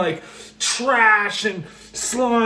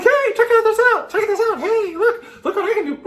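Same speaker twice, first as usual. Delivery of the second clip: far higher, giggly voices; laughing high creature voices; beings showing off; 13 semitones higher, faster than usual. Retold or imitated. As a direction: play eager little show-off spirits.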